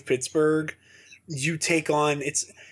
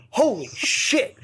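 slow, higher-pitched stunned exclamation; retold astonishment; 6 semitones higher, slower than usual. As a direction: draw out the shocked realisation. Retold moment of realisation.

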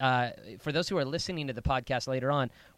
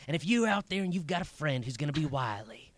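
Southern preacher voice. Acted. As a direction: preach it, Southern style, slowing down. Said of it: "that's my Southern preacher voice".

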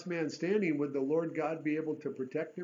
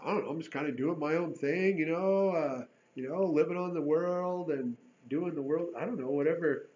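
imitating an excuse; 3 dB louder than usual. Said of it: "we would answer".

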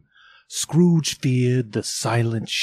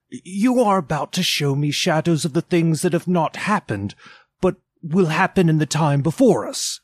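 higher, hushed awe of Scrooge; fearful Scrooge; 7 semitones higher, 5 dB louder than usual. Acted. Scrooge faces the silent phantom, uneasy and higher. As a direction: afraid to ask.

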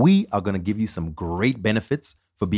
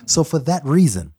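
ordinary talk

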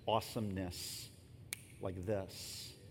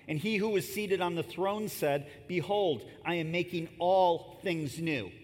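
louder, measured reading of the verses; scripture reading; 5 semitones higher, 4 dB louder, slower than usual. Acted. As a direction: proclaim the text to the congregation. Reads verse five aloud.

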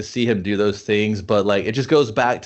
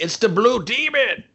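much higher, slow mock old man; old guy voice; 11 semitones higher, slower than usual. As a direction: ham up a panicked old townsman. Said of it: "And the old guy's like".

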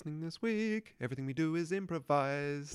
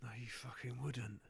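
half-whispered mock outrage; seething undertone; 9 dB quieter than usual. [name] agreeing Brazil has no fish and chips, whispered seething. Riled patriot agrees, barely voiced.

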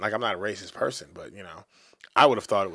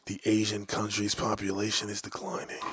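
softer, low, grave reading; hushed news voice; 5 semitones lower, 7 dB quieter than usual. Acted. Reading the Japan birth-rate story, hushed and grave. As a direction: drop into a hushed report. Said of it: "I changed my voice for that".

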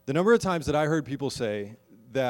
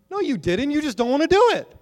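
sharp retort; 12 dB louder than usual. Acted. Rebutting an imagined excuse.

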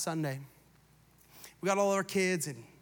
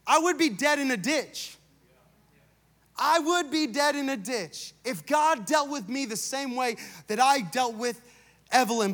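raised, deliberate pulpit delivery; emphatic preaching; 3 semitones higher than usual. Real, not acted. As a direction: hammer it home to a congregation, high and measured. Preacher driving point home, repeated.

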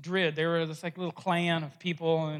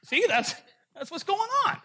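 exasperated Druid; 12 semitones higher than usual. Acted. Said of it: "Druid explains".

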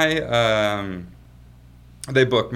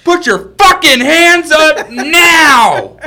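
full-volume high police scream, stretched out; mock furious shout; 16 dB louder, much slower than usual. Police out of patience with groom, screamed order. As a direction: imitate an officer yelling at the top of the lungs.

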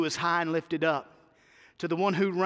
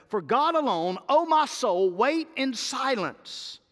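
rising fervent plea; 6 semitones higher, slower than usual. Acted. Preacher voicing David's psalm.

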